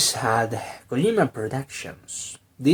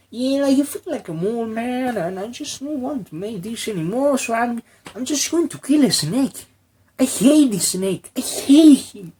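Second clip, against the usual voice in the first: lightly higher whiny Kojima impression; mock Kojima voice; 8 semitones higher than usual. Kojima explaining why he kills Snake, lightly voiced whine. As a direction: play a bitter old creator, half-hearted.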